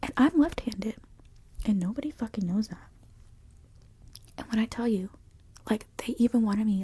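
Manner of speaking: loud, indignant self-defence; heated rant. Real, not acted. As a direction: argue your case with rising volume. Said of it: "Now I'm getting heated 'cause I'm remembering it all".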